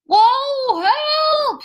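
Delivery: high, loud falling cry; scared cry for help. A narrator's reading. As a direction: yelp for help while falling. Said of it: "said Pooh as he dropped".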